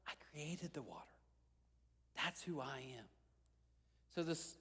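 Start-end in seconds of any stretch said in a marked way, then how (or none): 0.1-3.0 hushed whisper. Acted. Speaking as Jesus to the waiting man, soft whisper. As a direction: whisper it softly.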